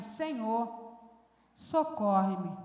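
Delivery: low, soft, slow plea; pleading prayer. Acted. The woman kneeling before Jesus, pleading low, soft and slow. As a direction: beg quietly for rescue, drawn out.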